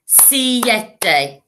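"siete" sounded out as si-e-te; three syllables. Shown as a demonstration. Teacher showing syllable count, "siete" split into three beats. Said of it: "three syllables".

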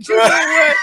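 sudden loud outburst, voice cracking; imitating a friend losing it; mock ballistic yell. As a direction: blow up at them in a hammed-up rage. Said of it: "Bobby goes completely ballistic".

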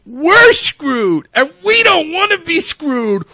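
high, slow mock bully shriek; ungov version. Host mocking the US trade stance, high shrieking threat. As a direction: squeal it like a bully making threats. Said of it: "Again, ungoving".

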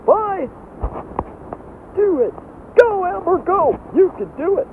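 high-pitched excited cheer. Pretend. Cheering Amber on.

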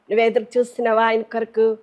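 ordinary talk, nothing marked

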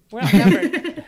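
bad British accent, shouted. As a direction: yell it in a hammed-up British accent. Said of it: "My bad British accent".

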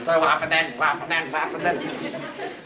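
softly badgering, trailing into a laugh; eager pestering. Child repeatedly pestering storyteller for more.

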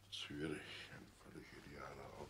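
breathless unvoiced murmur; stunned whisper. Father echoing his son's words in disbelief, stunned whisper. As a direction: barely get the words out, dazed.

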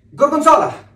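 shouting. Acted. Guessing game shout.

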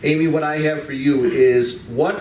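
usual speaking voice

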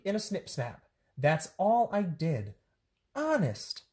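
high, lively storybook voices with swooping pitch; bouncy picture-book read-aloud; animated children's story reading. A narrator's reading. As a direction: read it to kids, playing up every silly word.

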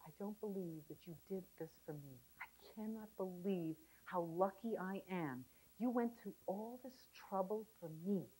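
quiet and moved, near tears; tearful husband. Retold or imitated. Husband moved to tears thanks his wife softly. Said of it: "he started crying".

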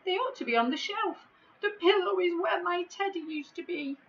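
high, fast tearful whimper; crying giant. Acted. The giant, starting to cry again, tells Tom about his pillow. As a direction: let the tears come back as you speak. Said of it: "began to cry all over again".

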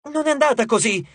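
indignant protest. A narrator's reading. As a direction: object firmly. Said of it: "protestò Hazel".

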